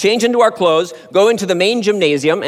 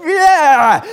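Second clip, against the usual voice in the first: loud, high theater cheer; louder, higher cheer as the villain dies; kids cheering; 7 semitones higher, 5 dB louder than usual. Retold or imitated. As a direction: whoop like a thrilled twelve-year-old. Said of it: "my friends and I watching would go".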